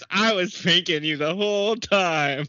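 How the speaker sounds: drawn-out, high mock gloat; imitated gloating. Imitating a man revealing his prank, mock gloating. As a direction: mimic a prankster revealing the joke. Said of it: "he was like".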